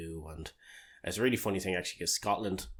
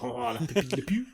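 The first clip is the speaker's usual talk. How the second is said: hammed-up French, higher and louder; a jokey French accent that slides toward German; mock French accent; 8 semitones higher, 7 dB louder, slower than usual. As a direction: toss off a cartoon French la pew. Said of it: "It always turns out German".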